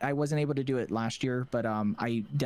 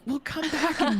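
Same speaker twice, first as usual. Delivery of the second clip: high pleading plea; 13 semitones higher, 3 dB louder, slower than usual. Retold or imitated. Pleading with absent friends.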